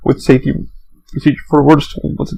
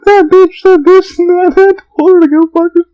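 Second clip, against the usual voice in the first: much higher, louder mock gossip voice; high, loud, hammed-up break-room gossip; gossiping coworker; 5 dB louder than usual. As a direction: play a coworker dishing about a guest. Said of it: "And, like".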